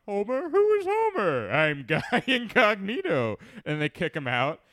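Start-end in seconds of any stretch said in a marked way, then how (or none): 0.1-3.4 much higher, very slow, drawn-out Guy Incognito; pompous stranger voice, stretching the name; fake gentleman. Man in top hat greeting the bar, hammed-up high pompous voice. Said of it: "the stranger answers the bartender".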